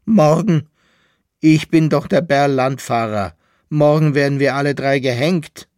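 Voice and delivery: high, loud and agitated; shrill outcry. A narrator's reading. Condemned Bärlandfahrer tells poodle of the hanging, shrill outcry. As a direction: cry it out with bitter disbelief. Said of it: "rief der Bärlandfahrer mit einem schrillen Lachen".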